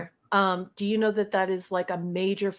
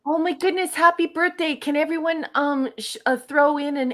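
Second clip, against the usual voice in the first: delighted, higher and louder; thrilled surprise at the news; excited; 7 semitones higher, 4 dB louder than usual. Real, not acted. Cohost hears of birthday.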